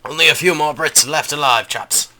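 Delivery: lightly hammed-up British officer accent; silly British accent. Player rallies his French troops, in a silly British accent. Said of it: "British accent for the French".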